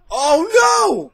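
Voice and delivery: gasp, then a high, loud cry; horrified shock. Real, not acted. Viewer watches the killer strike, horrified high cry.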